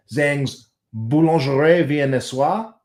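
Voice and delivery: slow, careful French accent, drawn out; attempted French accent. Real, not acted. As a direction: have a slow go at French pronunciation. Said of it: "Sorry about my accent".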